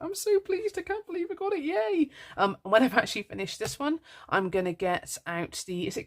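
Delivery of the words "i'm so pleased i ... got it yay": high, squealing excited voice; gushing delight. Retold or imitated. Retelling her thrilled reaction to the bundle, high and squealy. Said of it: "And I was like".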